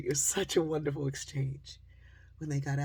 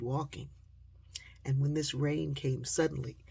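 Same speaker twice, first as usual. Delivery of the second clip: ordinary talk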